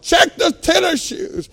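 loud, high-pitched demand; frantic shout. Retold or imitated. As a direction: holler the order, slow and emphatic. Acted shouting at doctor.

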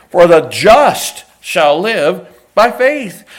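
loud, slow, every word weighed; proclaimed verse. Acted. Preacher proclaims the key verse, loud and slow. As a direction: proclaim it slowly.

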